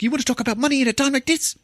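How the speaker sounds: very high, fast, incredulous outburst; hammed-up indignant squawk; mock outrage. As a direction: imitate a man appalled at haggling mid-crisis. Outraged reply to contract haggling.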